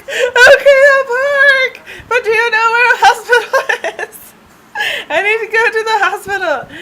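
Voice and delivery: very high, loud tearful wail; hammed-up hysterical blubbering; mock crying. Stung driver explaining to a stranger, mock crying wail. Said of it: "I just start crying".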